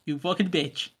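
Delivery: a silly over-the-top Irish man teasing; comical Irish accent. Pretend. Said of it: "that is a comical accent".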